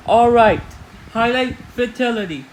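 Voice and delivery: usual speaking voice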